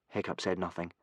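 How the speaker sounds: ordinary delivery, nothing marked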